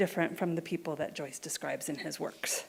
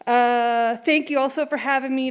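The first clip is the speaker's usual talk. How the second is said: usual speaking voice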